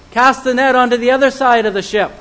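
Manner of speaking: a loud, firm order to the fishermen; commanding call. Acted. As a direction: call it out across the water. The Lord's command quoted.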